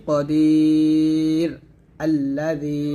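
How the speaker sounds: slow chanted pause-form কদির আল্লাযী; recitation demo with a stop. Shown as a demonstration. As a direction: stop on কদির, stretch it, then start আল্লাযী. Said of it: "এরকম".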